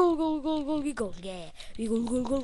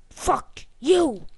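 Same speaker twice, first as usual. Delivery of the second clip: angry yell; 3 semitones higher, 8 dB louder than usual. Real, not acted. Yelling at game.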